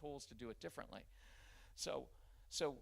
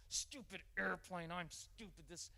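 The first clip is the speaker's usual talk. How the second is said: put-on frustrated kid; 4 semitones higher, 3 dB quieter, slower than usual. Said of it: "what are you gonna get".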